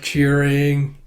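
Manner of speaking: ordinary delivery, nothing marked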